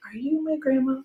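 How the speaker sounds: put-on a wary whisper to the baby; high quiet question. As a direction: lift it high and soft. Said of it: "I'm like".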